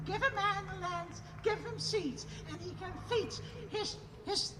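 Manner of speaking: ordinary delivery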